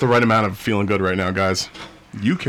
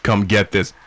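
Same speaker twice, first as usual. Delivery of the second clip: mock come-on. Mocking the inmate ad.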